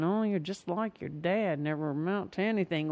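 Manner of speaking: soft, low parental disparagement; a hushed mock-mom put-down. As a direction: mutter it like a remembered reproach. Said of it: "things that are even quieter than that".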